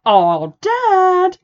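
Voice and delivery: high, loud exasperated whine; mock teenage eye-roll at dad; whiny teen. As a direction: sitcom "Oh, Dad!". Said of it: "And she's like".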